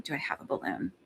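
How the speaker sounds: muttered under her breath, unvoiced; whispered aside. Real, not acted. Searching the room for a balloon, whispering to herself. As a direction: whisper it to yourself while searching.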